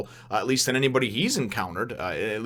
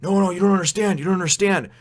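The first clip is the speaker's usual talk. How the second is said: higher, insistent warning; lightly acted desperate warning; urgent plea; 6 semitones higher, 5 dB louder than usual. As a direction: make them understand, pitched up. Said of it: "and he's".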